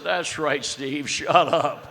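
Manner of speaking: loud mock scolding, drawn out at the end; slow down into a loud, comic put-down; retold telling-off. Marge silencing Steve in front of staff, mock scolding.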